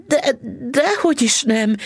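flustered high stammer; wife stammers out a denial, high and flustered. A narrator's reading. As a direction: stumble over the words in a high, flustered voice. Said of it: "hebegte Missus Bixby".

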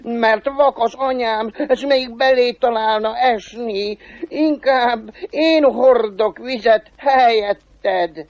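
high, drawn-out begging; pleading devil. Acted. Devil offering to carry water instead, desperately pleading. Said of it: "rimánkodott az ördög".

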